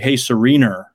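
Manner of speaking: "Serena" ending in an R, "Sereener"; added R. Retold or imitated. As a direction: say "Serena" with an R stuck on the end. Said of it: "add 'em where there weren't".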